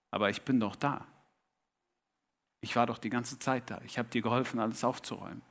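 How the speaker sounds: low, soft voice of the guest; quietly reassure, as the helper revealing himself; gentle reveal. A narrator's reading.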